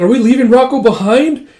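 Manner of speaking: high, loud anxious question; worried plea. Acted. Erica begging not to leave Rocco. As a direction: ask it near tears.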